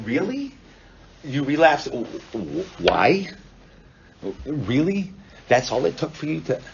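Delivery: loud, incredulous counselor; punchy, judgmental questioning; denigrating disbelief. Acted. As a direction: scoff in loud disbelief at a weak excuse. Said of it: "now they denigrate the client".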